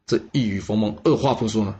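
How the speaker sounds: usual speaking voice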